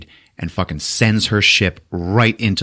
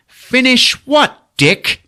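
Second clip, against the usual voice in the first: high, louder slow mock sneer; sneering retort; 4 dB louder, slower than usual. Hux mocks Kylo's order, high sneering retort. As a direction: scoff at him with contempt.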